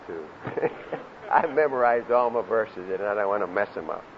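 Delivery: normal talking voice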